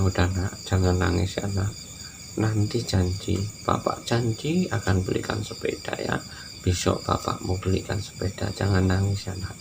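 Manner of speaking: put-on soft, gentle comfort for a crying child; soothing father. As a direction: hush the little one tenderly. Ayah menghibur anak menangis. (ayah menenangkan anaknya yang menangis, lembut dan pelan)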